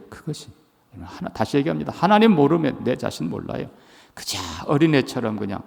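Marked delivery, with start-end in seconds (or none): none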